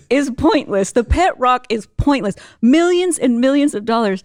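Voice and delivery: high, punched incredulity; comic emphatic rant. Retold or imitated. Speaker listing silly products to young lady, comically incredulous. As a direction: hammer out the absurdity for laughs.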